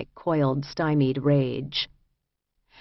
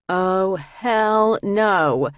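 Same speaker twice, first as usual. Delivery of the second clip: long drawn-out, higher and louder disbelief; disbelieving; 4 semitones higher, 5 dB louder, much slower than usual. A narrator's reading. Narrator to her co-defendants on seeing the psych ward, drawn-out disbelief. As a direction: stretch it out in loud, incredulous refusal. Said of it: "a disbelieving and desperate laugh".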